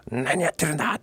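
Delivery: mock yell. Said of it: "怒涛型をね".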